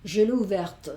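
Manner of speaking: "ouverte" with the T pronounced at the end; sounded final T. Shown as a demonstration. Teacher demonstrating feminine agreement, sounded T in "ouverte". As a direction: let the last T of "ouverte" be heard. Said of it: "esta T que no sonaba, sí que suena".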